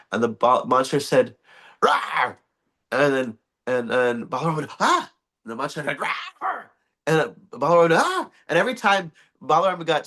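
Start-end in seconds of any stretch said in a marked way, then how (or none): 1.8-2.4 monster roar. Acted. Monster attacks in folk story. 4.8-5.1 high startled yelp; a high squeak of fear; frightened cry. Acted. Balaram frightened by monster. 6.0-6.6 monster roar. Acted. Monster attacks again. 7.9-8.3 high startled yelp; frightened cry. Acted. Balaram frightened again.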